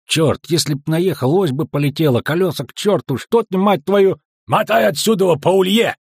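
high, loud cursing outburst; angry bark. A narrator's reading. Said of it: "рявкнул Ярпин Зигрин". (зло рявкает, ругаясь)

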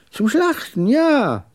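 put-on high, emphatic old man's confirmation; jagged old man. A narrator's reading. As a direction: repeat it high, ending on a loud yes. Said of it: "Seine Stimme hatte etwas Schartiges".

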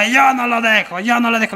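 high, stubborn cried-out refrain; drunken shouting. Acted. Drunk defies the policeman, high stubborn shouting. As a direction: insist on it in a high holler.

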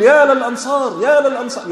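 high-pitched, urgent repeated summons; rallying herald's call. Retold or imitated. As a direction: call out to fire up your brothers. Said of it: "يحمس إخوانه".

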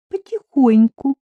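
understated modesty; modest reply. A narrator's reading. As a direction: answer modestly with a lift in the voice. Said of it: "скромно ответила Амина".

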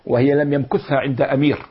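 usual speaking voice

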